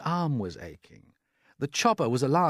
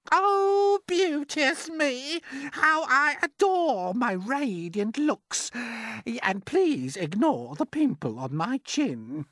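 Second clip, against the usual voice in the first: high, slow, preening aunt's voice; sing-song self-admiring old aunt, stretched out; vain aunt; 5 dB louder, slower than usual. Acted.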